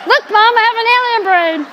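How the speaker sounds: put-on high, fast excited child voice; playful imitation of a young boy, high and quick; excited little boy. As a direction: mimic a proud little son showing off. Mother voices her young son.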